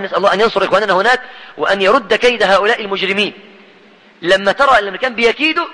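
normal talking voice

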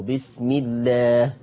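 بسم الله drawn out slowly with a thin, clear "ll"; light lam. Shown as a demonstration. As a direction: recite بسم الله as "bismi-llaah" with a fronted long "aa". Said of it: "ولو جه قبل منه كسر هنرققه".

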